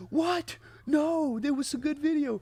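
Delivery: high, loud indignant cry; outraged disbelief. Retold or imitated. Podcast host retelling his reaction to a flop, high and loud. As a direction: protest that the video was good. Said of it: "I'm like".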